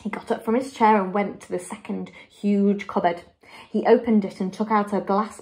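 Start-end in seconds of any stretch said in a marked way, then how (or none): none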